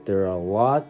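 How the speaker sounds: normal talking voice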